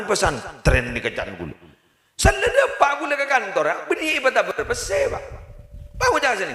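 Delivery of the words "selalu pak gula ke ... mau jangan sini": calling out retold. Boss calling his helper, high mock call.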